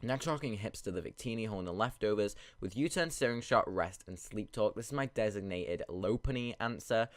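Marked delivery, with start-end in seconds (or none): none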